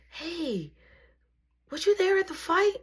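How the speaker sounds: high-pitched geeky schoolboy; geeky boy. Retold or imitated. Geeky boy stopping a classmate at the lockers, high-pitched voice. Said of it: "the geeky boy".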